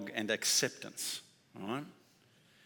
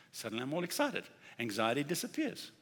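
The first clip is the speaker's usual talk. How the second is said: ordinary talk, nothing marked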